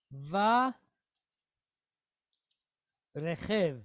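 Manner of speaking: Va Rechev said with a guttural ch in Rechev; Hebrew word demo. Shown as a demonstration. Teacher joins the syllables into a word, careful Hebrew pronunciation. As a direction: sound out Va Rechev slowly. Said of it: "So we have Ele Varechev".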